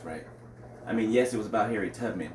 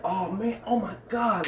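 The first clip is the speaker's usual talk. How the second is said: higher, excited mock outburst; pumped; 9 semitones higher, slower than usual. Retelling feeling pumped at the movie, excited. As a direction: relive the thrill of watching. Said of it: "like, just pumped".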